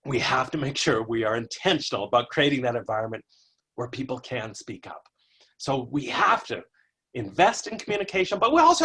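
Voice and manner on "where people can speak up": soft whisper; breathy hushed aside. Shown as a demonstration. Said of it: "whispered line".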